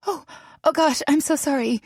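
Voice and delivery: high, flustered apology; embarrassed guest stammering sorry; flustered stutter. Acted. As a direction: stumble over the words, caught naked. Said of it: "McKinley stuttered".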